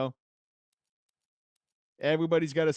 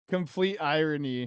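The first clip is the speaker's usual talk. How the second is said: ordinary delivery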